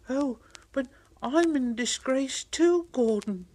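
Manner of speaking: high, bright voice; eager little engine. Acted. Thomas telling Gordon he too is in disgrace. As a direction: pipe up keenly.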